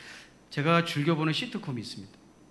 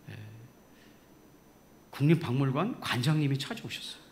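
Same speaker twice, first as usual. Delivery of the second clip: ordinary talk, nothing marked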